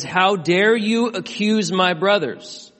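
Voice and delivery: loud, slow, indignant defender; Jesus defending. Acted. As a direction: stand up for your brothers. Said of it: "He rises from the dead and says".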